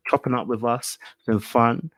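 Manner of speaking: usual speaking voice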